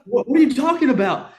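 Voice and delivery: loud, high, disbelieving retort; retold incredulity. Hunter challenging dad's pig sighting, retold incredulous retort. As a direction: throw it out high and loud in mock bewilderment.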